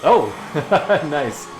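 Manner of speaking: usual speaking voice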